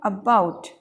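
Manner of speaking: about with a tiny a, stressed -BOUT; short first syllable. Shown as a demonstration. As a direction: clip the a, hit BOUT in about. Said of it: "ये छोटा सा बोलना है".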